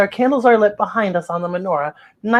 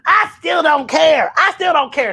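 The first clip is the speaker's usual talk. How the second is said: louder, higher grumbled refusal; sulky teen; 8 semitones higher, 4 dB louder than usual. Acted. Teen Lex refusing to join the fun, sulky shout. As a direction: sulk it out like a teen who won't join. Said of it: "says Lex".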